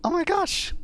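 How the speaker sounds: high thrilled exclaim; excited squeal. Real, not acted. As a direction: squeal with delight. Broke bedrock.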